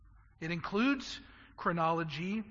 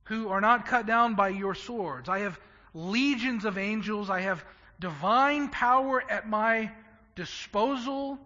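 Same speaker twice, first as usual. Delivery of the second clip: louder, ringing declaration of divine power; Jesus defying his captors; 5 semitones higher, 9 dB louder than usual. Acted. Preacher voicing Jesus to those who come to arrest him, louder and commanding. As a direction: proclaim it like a king who cannot be taken.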